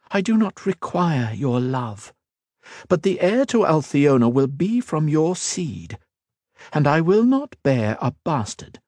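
high, poised royal voice, slowing at the end; a proud queen making a veiled threat; cool queen voice. Acted. The Queen answers coldly.

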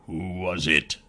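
soft, low sighing king; sighing creature. A narrator's reading. Cursed king absolves the hero, soft low sigh. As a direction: let it out on a long, low sigh. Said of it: "He shook it off and sighed".

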